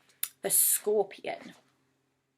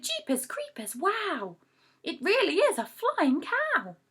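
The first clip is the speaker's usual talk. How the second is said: excited shout; 8 semitones higher, 4 dB louder, slower than usual. Acted. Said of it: "They shouted".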